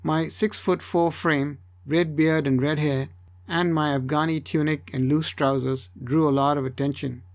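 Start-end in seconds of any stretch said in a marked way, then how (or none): none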